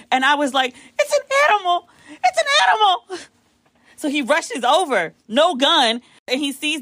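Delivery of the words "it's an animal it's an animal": very high, crying half-laughing shriek; terrified high squeal; panicked shriek. Retold or imitated. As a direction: scream it like something will eat you. Panicked at supposed hyena.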